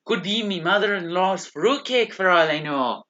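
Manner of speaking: Scottish mason voice, mock gruff; Scottish accent. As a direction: play the grumbling Scottish mason. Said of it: "I'm really good at a Scottish accent".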